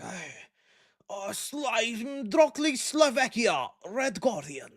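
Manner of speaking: hammed-up Russian accent, slow and high on Slovakia; playful fake Russian accent greeting Red Guardian; mock Russian accent. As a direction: put on a goofy Russian accent like a superhero fan. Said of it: "Russian accent".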